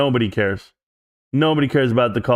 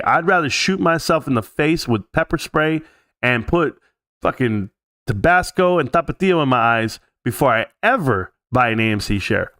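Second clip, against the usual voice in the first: normal talking voice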